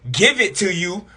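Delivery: normal talking voice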